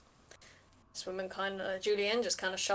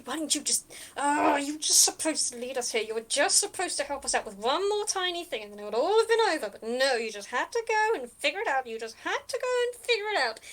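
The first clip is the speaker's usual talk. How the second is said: higher, exasperated, building rant; sputtering tirade; 5 semitones higher, 4 dB louder than usual. Acted. Captive Julianne berates her bungling accomplice, sputtering angrily. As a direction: sputter it out in a rising scolding rush. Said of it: "she's sort of sputtering off".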